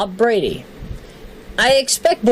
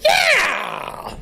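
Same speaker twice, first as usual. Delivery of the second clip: very high rally scream held in check; high, strained yeah, mimicking a politician's scream; Howard Dean. List reader showing the famous candidate yell, high strained scream. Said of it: "I would do that".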